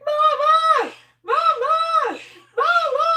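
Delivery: high, loud repeated wail; calling for mama. Retold or imitated. Parrot mimicking a boy calling mom, loud high wail. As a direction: cry out for mom again and again. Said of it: "And then he's like".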